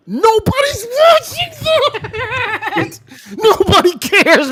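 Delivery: high, laughing yell; laughing shout. Real, not acted. Ranting at his cohost, shouting high through laughter. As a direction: shout it while cracking up.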